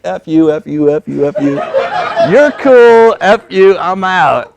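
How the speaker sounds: half-laughing rant building to a shout; retold cursing outburst. Walking out on the boss, laughing mock yell. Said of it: "made me lose my mind".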